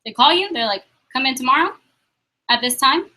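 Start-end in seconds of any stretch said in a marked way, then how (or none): none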